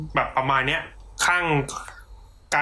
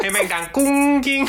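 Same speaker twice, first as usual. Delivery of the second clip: high-pitched mock wind-chime ringing; chime imitation; 11 semitones higher, 3 dB louder than usual. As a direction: let the jingle word ring out high.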